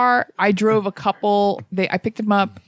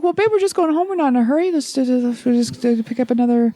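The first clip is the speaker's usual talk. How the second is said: put-on higher-pitched placating boyfriend voice; pleading boyfriend; 3 semitones higher than usual. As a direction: imitate a boyfriend nervously explaining himself. Boyfriend placating his angry girlfriend.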